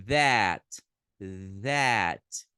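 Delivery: "that" loud, with an open é vowel; emphatic teacher demo of "that"; English pronunciation. Shown as a demonstration. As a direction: punch "that" with an open é. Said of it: "Eles falam é".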